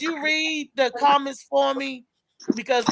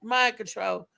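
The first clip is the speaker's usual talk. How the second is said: ordinary delivery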